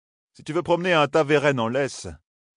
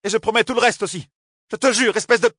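high, louder heated shout; 8 semitones higher, 4 dB louder than usual. Acted. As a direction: keep yelling the promise. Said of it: "cria Nineveh".

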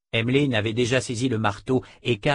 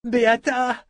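high loud happy cry; young man greeting his friend, joyful; joyful call; 12 semitones higher, 4 dB louder than usual. A narrator's reading. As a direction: greet an old friend with delight. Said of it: "lança joyeusement le jeune Haken".

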